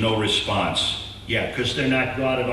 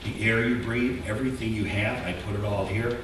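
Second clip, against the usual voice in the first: softer, tender and sincere; tender God voice; 3 semitones lower, 8 dB quieter than usual. Acted. God tells Israel softly why he chose them. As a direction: say it as a loving promise. God's loving words to Israel.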